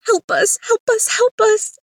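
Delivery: high, loud, pathetic wail; whiny yelling creatures. A narrator's reading. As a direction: shriek for help in a pitiful whine. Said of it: "They yelled".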